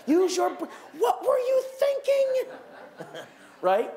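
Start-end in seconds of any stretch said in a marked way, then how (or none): 0.1-2.5 scolding parent. Retold or imitated. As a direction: scold a teenager with mock outrage. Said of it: "they're taught to say to their teenagers".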